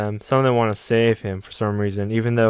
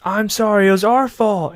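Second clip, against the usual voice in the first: much higher, louder apology; apologetic Armin; 9 semitones higher, 4 dB louder than usual. Retold or imitated. Armin apologizing to Eren, much higher plea. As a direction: beg forgiveness. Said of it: "He tries a different way and says".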